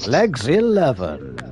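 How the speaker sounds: loud drawn-out bingo call; bingo caller. Pretend. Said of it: "Eyes down for Full House".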